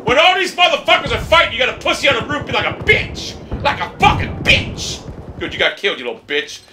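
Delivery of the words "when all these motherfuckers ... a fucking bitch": enraged shouting. Real, not acted. Gamer cursing a teammate on the roof, enraged shouting. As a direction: rant at a useless teammate, pitch soaring.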